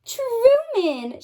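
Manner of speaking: high joyful cry of the name; delighted cry. Acted. Sarah finding her tortoise, crying out. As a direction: cry out his name in glad surprise. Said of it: "she cried".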